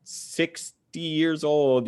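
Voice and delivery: high, drawn-out amazement; mock disbelief. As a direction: act out being floored, stretching the words slowly. Retold awe at older lifter.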